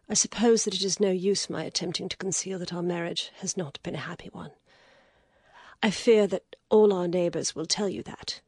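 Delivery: an exhausted lady, reluctant; weary lady. Acted. Lady tells her story.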